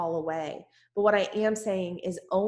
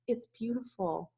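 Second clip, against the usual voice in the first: dreamy awe; 7 dB quieter than usual. Retold or imitated. Awed at new pool pavers.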